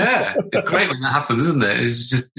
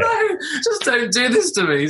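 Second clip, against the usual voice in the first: slow plea through helpless laughter; laughing despair; 11 semitones higher than usual. Retold or imitated. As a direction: beg the moment away, laughing slowly. Embarrassed dad repeats inner plea.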